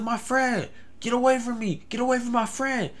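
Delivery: high-pitched mock protective yell; imitated shouting. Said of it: "this guy is shouting".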